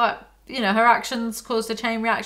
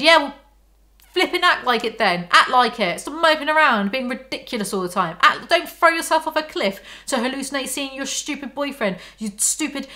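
raised, ranting tell-off of a fictional character; exasperated scolding; 5 semitones higher, 3 dB louder than usual. Pretend. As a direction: scold her loudly in mock outrage. Exasperated rant addressed to the character.

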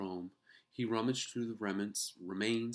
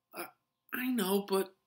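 stammering mouse; 13 semitones higher, 7 dB louder than usual. A narrator's reading. Ralph stammering.

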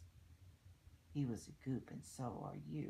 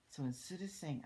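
plain reading voice

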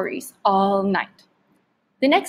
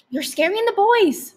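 scolding; 10 semitones higher than usual. A narrator's reading. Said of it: "Father scolded her".

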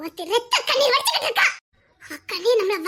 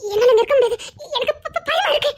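sobbing stammer; 2 semitones higher than usual. Acted. Wife stammering through sobs that she is afraid. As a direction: choke on the word as the sobs take over.

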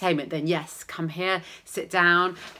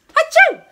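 high, loud fake sneeze; hammed-up sneeze; 11 dB louder than usual. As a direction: let rip a big sneeze.

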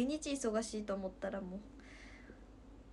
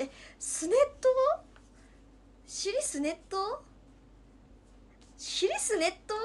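mock puzzled; 9 semitones higher, 7 dB louder than usual. Mocking odd dialect word.

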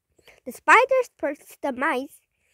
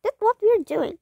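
higher-pitched, lightly acted retort; called out; 7 semitones higher than usual. A narrator's reading. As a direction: call it back with raised pitch. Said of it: "called the spider".

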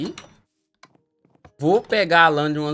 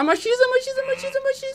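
high sing-song call. Real, not acted. Calling teammate in silly voice.

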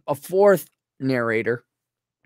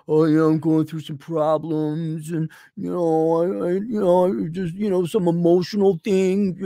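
mock whiny excuse. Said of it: "You're not on the phone like".

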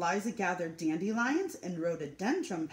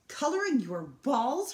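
a high, disbelieving exclamation; exclaiming; 6 semitones higher, 5 dB louder than usual. Acted. Mother repeating Liza's answer, disbelieving exclamation. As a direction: repeat the word back in disbelief. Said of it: "her mother exclaimed".

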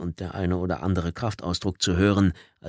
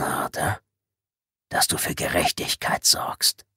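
toneless whisper, unhurried and menacing; hissed whisper; 4 semitones lower than usual. Acted. Intruder reminds Tommy of his orders, whispered. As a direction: whisper the threat right into his ear. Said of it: "zischte die erste Stimme".

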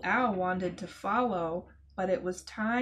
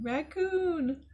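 excited call; 6 semitones higher than usual. Real, not acted. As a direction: call the name out high and bright, with a falling end. Kids greet returning raccoon character.

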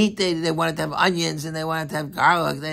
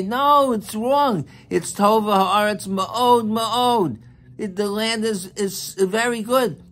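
slow, higher, emphatic protest; insistent rebuttal; 6 semitones higher, slower than usual. Retold or imitated. Kalev answering the spies, slow insistent rebuttal. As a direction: correct them firmly, word by word. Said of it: "what did Kalev and Yeshua say?".

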